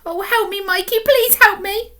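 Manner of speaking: put-on high, urgent child's plea; girl begging her brother for help; pleading. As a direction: beg your brother for help. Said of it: "with pleading eyes".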